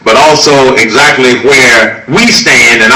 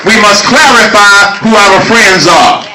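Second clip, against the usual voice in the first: rally oratory; 12 semitones higher than usual. Real, not acted. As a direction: hammer each line home to the crowd. Rally speech at full force.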